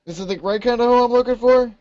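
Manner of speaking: high-pitched, put-upon guard voice; groggy woken guard. Acted. Woken guard to dwarf with hoe, high whine. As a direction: whine it high, just roused.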